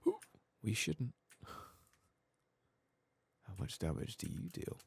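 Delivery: usual speaking voice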